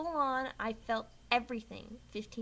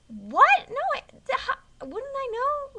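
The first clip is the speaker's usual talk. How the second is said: high, loud shocked outburst; shocked disbelief; 14 semitones higher, 12 dB louder than usual. Retold or imitated. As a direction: caught off guard, voice jumping up. Shocked reply to dentist.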